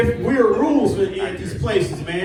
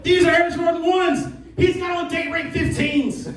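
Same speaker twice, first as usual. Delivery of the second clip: indignant protest; 11 semitones higher than usual. Retold or imitated. Arguing with the bouncer over sneakers, high and outraged. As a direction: protest the bouncer's double standard. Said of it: "I'm like".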